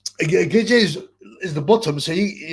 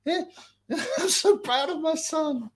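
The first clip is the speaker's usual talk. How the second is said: high mock pride; 13 semitones higher than usual. The dad about his son, high mock pride.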